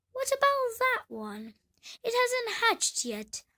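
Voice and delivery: put-on a fussy old duck peering at the egg. Old duck voiced in story.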